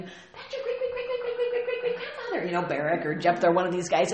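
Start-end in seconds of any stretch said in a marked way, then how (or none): 0.0-2.5 put-on high old grandma voice; grandma nudging. Grandma nudging her nephew, high granny voice. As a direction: squeak it out like a proud granny. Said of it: "maybe grandma is nudging his, her nephew".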